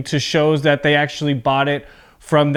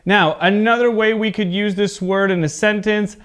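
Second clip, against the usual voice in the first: usual speaking voice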